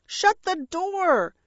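high, drawn-out shriek. A narrator's reading. Parrot as crows invade, high shriek. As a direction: shriek the order, slowly stretched. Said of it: "Bertie shrieked".

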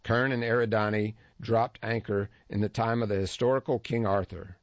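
usual speaking voice

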